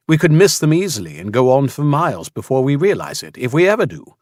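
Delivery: put-on urgent, persuasive young man; earnest young man. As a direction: press the point with growing urgency. Ben's pleading argument.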